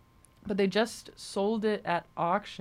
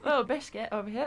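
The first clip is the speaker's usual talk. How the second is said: pitched-up mock British voice; bad British accent; 4 semitones higher than usual. Host joking with cohost, mock British accent. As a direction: toss it off in a fake Brit accent. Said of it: "That's enough of bad British accents".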